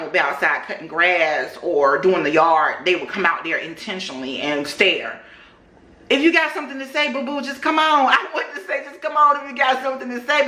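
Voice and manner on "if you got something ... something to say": sassy challenge. Pretend. Challenge to staring neighbors.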